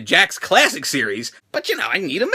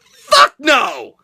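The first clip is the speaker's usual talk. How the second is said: louder, higher final shout; shouted refusal; 6 semitones higher, 5 dB louder than usual. Real, not acted. Vlogger capping the rant for viewers, loud shouted refusal. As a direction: slam the answer down with a shout.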